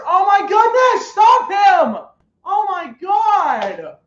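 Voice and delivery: slow, high, loud yells at the screen; real excited shout. Real, not acted. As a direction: shout at the TV as the runner breaks free. Watching long run live.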